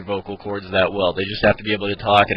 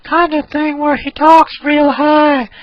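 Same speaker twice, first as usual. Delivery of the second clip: put-on much higher, louder and slow old man voice; Herbert voice; 9 dB louder, slower than usual. Shown as a demonstration. Teacher demos Herbert for viewers, high creaky old man. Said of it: "And the voice is this".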